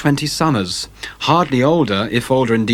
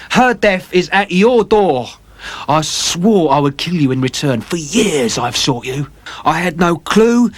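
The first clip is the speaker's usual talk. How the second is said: vengeful sailor; 5 semitones higher than usual. Acted. James Vane's held voice.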